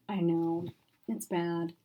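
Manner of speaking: usual speaking voice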